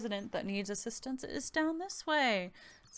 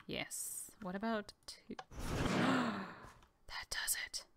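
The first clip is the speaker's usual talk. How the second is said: whispering; 5 dB quieter than usual. Real, not acted. Solving puzzle excitedly.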